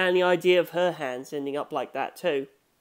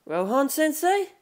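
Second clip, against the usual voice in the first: higher, slow, questioning call in the companion's voice; high, drawn-out young voice calling a name; 9 semitones higher, slower than usual. Acted. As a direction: call his name gently, unsure he hears. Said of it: "I called out to him".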